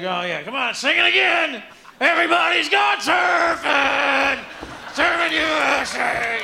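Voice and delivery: high, slow mock crowd-pumping singer's shout; burned-out singer. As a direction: ham up a tired rock star working the crowd. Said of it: "the guy's like".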